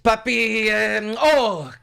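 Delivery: high, hammed-up Spanish-accent fumbling; mock Spanish accent. Mocking a guest hunting for words, Spanish accent. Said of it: "she had a Spanish accent".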